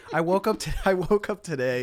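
high, emphatic, half laughing; loud jokey protest. Real, not acted. Defending getting political today, loud jokey protest. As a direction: insist on it loudly, amused. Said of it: "You said it the same way".

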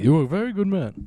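high, put-on Indian accent; Babu Bhatt impression. Babu Bhatt scolding, high put-on Indian accent. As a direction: do Babu from Seinfeld. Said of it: "Babu Bhatt".

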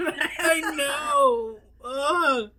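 high, loud laughing groan; laughing disgust. Real, not acted. Co-host dreading hearing it back, laughing disgust. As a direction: cry it out through laughter, grossed out.

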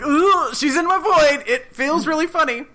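hammed-up ticklish complaint; squirmy Janet. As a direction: squirm as someone invades you. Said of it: "Janet's like".

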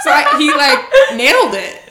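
high, giggling through the words; laughing. Real, not acted. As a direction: laugh as you say it. Laughing while telling it.

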